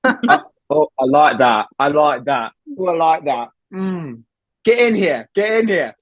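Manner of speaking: lightly put-on British accent, coaxing; a chummy Brit egging on his friend. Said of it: "In a British accent".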